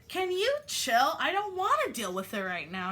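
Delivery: mock irritated dismissal; annoyed brush-off. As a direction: wave her off, exasperated. Voicing the dismissive self.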